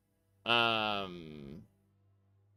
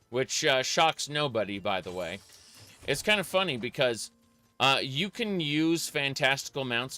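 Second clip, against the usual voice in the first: normal talking voice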